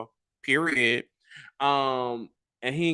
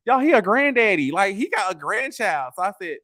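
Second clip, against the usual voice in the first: normal talking voice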